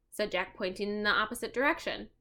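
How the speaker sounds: usual speaking voice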